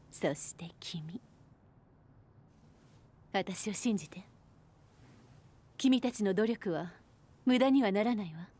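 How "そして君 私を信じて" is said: intimate whisper. Acted. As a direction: close in and whisper your trust. Intimate reassurance to the player. (優しいささやき)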